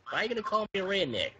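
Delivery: put-on country accent. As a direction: put on a country twang, challenging. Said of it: "I can do a country accent".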